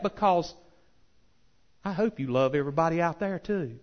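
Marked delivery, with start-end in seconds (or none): none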